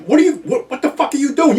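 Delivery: high, loud, rising cry of disbelief; exasperated disbelief. Retold or imitated. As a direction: yelp at your friend like he's lost his mind. Retold panic at a friend.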